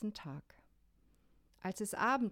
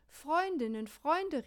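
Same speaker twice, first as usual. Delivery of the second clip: higher, louder call for help; 7 semitones higher, 4 dB louder, slower than usual. A narrator's reading. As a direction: call your friends urgently. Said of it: "rief er". (hoher, lauter Hilferuf)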